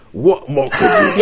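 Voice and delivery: the question cracks into a laugh. Real, not acted. Host laughing mid-pitch, S0 laughs back.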